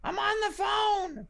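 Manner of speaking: high, drawn-out mocking whine; squeaky, slow imitation of a caller's protest; [name]. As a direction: mimic a teenager whining at mom. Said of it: "you said this".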